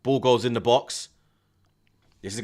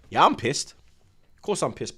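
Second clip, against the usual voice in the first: ordinary talk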